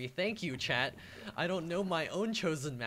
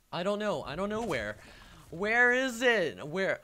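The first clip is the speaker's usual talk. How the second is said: puzzled outburst, stretching the question; baffled exclamation; 6 semitones higher, 7 dB louder than usual. Real, not acted. Baffled at player's chat message.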